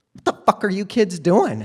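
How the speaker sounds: loud, high, furious mother; mom yelling. Retold or imitated. Mom catching the kids and yelling at them, loud angry shout. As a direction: bark it at the kids with a laugh underneath. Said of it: "My mom snatches me by the arm and says".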